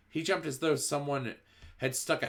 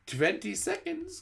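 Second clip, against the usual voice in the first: high, slow witch countdown; witch crying out; slower than usual. A narrator's reading. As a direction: call out the seconds gleefully. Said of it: "cried the Grand Wa".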